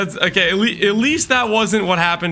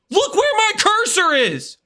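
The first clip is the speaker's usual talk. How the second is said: higher, stress on where, exasperated; exasperated much higher. Real, not acted. Exasperated insisting louder.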